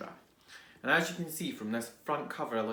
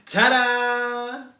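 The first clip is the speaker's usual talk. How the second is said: loud high flourish; bright triumphant ta-da; ta-da reveal; 9 semitones higher, 11 dB louder than usual. Acted. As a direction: present it proudly.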